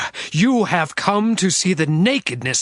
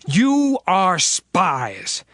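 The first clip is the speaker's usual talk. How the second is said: slow accusation; much slower than usual. Acted. Joseph accusing his brothers.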